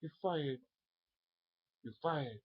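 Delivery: high, put-on Trump catchphrase; Donald Trump impression. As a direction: mimic Trump firing someone. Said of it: "Donald Trump".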